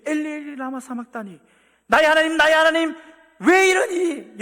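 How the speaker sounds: high, loud anguished cry; Jesus crying out on the cross. Acted. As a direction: cry out abandoned, in agony.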